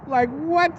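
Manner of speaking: high, incredulous what; baffled what. Real, not acted. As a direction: sound totally thrown. Baffled by goat costume guy.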